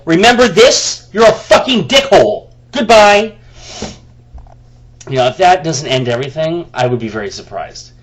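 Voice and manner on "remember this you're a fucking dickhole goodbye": loud, raised, furious telling-off; imagined comeback, shouted high and loud; angry shouted retort. Retold or imitated. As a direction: yell it at the old friend. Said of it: "we're gonna go".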